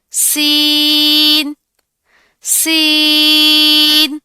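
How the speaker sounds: سین drawn out very slowly, high and loud; model letter seen. Shown as a demonstration. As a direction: stretch سین with a hiss. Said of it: "سین کا بھی same زا کا مخرج ہے". (سین لمبی سیٹی کے ساتھ)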